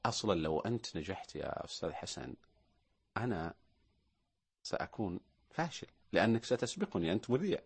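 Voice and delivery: low, hushed jealous confession, ending in a whisper; envious man. Pretend. Host playing an envious colleague of the presenter Hasan, low and hushed. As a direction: grudge muttered quietly.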